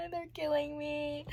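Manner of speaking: high, slow whimper; tearful. Real, not acted. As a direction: whimper it near tears. First take.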